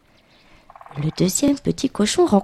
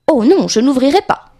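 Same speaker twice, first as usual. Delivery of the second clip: higher, louder storybook refusal; defiant little pig; 5 semitones higher, 5 dB louder than usual. Acted. Little pig answers the wolf at the door, defiant. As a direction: refuse firmly as a lightly voiced piglet.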